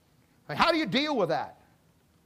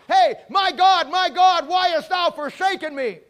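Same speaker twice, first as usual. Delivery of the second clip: quoted cry; 7 semitones higher, 8 dB louder, slower than usual. Retold or imitated. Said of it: "and he yelled out".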